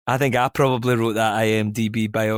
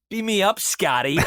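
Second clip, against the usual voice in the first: higher, tentative American accent; 8 semitones higher than usual. Shown as a demonstration. Actor put on the spot by podcast hosts, shaky American accent. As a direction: attempt an American accent, pitched up. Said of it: "in your American accent?".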